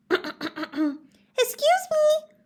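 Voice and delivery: very high, slow little cricket voice; polite cricket cough. Acted. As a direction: clear your throat as a tiny cricket. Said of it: "coughed a cricket".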